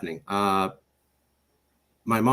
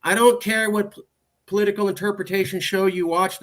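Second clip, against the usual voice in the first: imitating a higher-pitched, annoyed mother; dismissive mom; 6 semitones higher than usual. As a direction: brush off your kid's excuse. Mom dismisses his political news.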